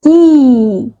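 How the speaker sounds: की said slowly and distinctly as a long kee; clear sound demo. Shown as a demonstration. Teacher showing children how to say की, carefully. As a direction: model की with a drawn-out long ee vowel. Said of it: "उच्चारण किस प्रकार से होगा".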